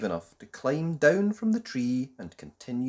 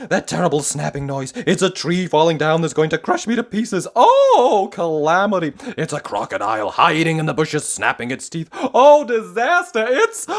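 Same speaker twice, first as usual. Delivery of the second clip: high, loud frantic babbling; panicked squeal; 6 semitones higher, 13 dB louder than usual. Acted. Mister Jelly imagining crocodiles, panicking. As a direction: gabble faster and faster in terror.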